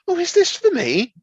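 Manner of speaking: high mock-surprised mum; mum's surprised voice. Teller voicing mum reading the label, high and eager.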